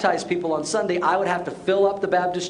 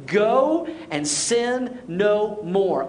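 very slow, each word of "go and sin no more" weighted; emphatic command; much slower than usual. Acted. Jesus releasing the forgiven woman, slow and emphatic. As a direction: draw out "go", then space "sin no more".

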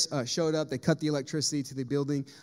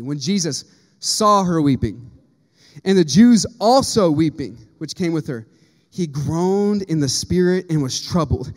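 slow, heavy, louder Bible reading; solemn scripture reading; 8 dB louder, slower than usual. Acted. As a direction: read each word of the verse slowly, letting it land.

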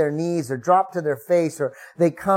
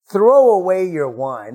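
slow, louder command; stern order; 3 semitones higher, 7 dB louder than usual. Acted. Eli commanding the praying woman, slow and loud. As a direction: order her to put the wine away. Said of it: "he demanded".

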